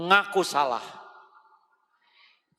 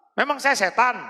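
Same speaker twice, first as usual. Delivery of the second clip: sharp, louder indignant retort from a wife; offended wife; 9 semitones higher, 4 dB louder, faster than usual. Acted. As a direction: snap back, insulted. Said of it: "kalau agak keras bisa".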